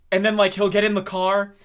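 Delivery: ordinary talk, nothing marked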